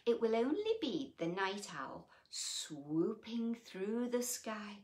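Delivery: lilting storybook read-aloud; reading to children. A narrator's reading. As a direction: read it like a bedtime picture book. Reading picture book aloud.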